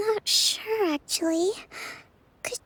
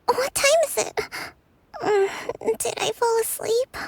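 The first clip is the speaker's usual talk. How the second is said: jolted awake; 5 semitones higher than usual. Acted. Kokomi waking suddenly.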